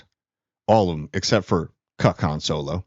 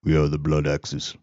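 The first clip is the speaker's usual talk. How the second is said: lower, softer imitation of a self-serious warrior; mock deep voice; 7 semitones lower, 3 dB quieter than usual. Mocking the actor's overdone deep voice, lower and softer. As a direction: parody his put-on deep voice. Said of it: "emphasize the extra deep voice".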